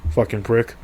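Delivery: usual speaking voice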